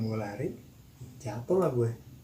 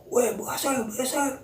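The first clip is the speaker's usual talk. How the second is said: high-pitched retold shout; teriakan tiruan; 11 semitones higher, slower than usual. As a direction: call out in a high raised voice. (teriakan tiruan bernada tinggi)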